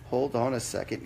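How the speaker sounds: ordinary talk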